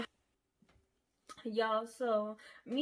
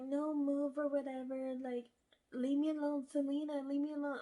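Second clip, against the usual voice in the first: mocking him; 5 semitones higher than usual. Boyfriend voiced pushing her away.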